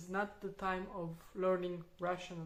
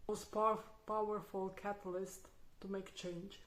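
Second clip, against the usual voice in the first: usual speaking voice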